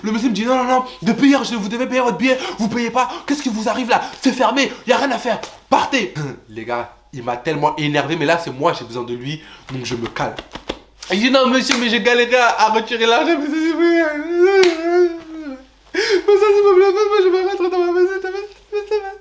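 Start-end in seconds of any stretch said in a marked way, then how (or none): 0.4-6.1 high, dismissive retold refusal; stern airport official. Airport agent refusing late passenger, dismissive retold voice. 11.3-19.1 very high, whiny begging voice; pleading. Retold or imitated. Traveller begging airport agent to let him board, high pleading. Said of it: "il a fallu que je le supplie".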